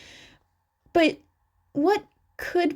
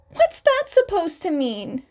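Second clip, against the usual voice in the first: mildly offended; 6 semitones higher, 3 dB louder than usual. A narrator's reading. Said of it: "eyebrows furrowed in mild offense".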